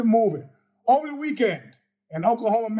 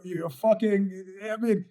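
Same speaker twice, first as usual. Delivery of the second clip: usual speaking voice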